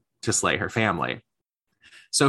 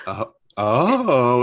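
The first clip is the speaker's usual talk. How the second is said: drawn-out, louder mock-awed oh; mock dramatic reaction; 6 dB louder than usual. Host teasing co-hosts for dramatic nodding, mock dramatic. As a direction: imitate an over-impressed listener, swelling the second oh. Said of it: "the, the dramatic head movements".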